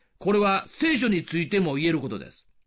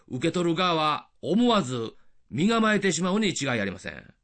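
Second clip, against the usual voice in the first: ordinary talk, nothing marked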